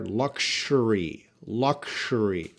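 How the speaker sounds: "Luxury" said slowly as LUK-shuh-ree, with an SH sound; slow pronunciation demo. Shown as a demonstration. Teacher showing students the SH sound in "Luxury", slowly. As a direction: stretch out "Luxury" and land on the sh in the middle. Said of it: "S H sound there".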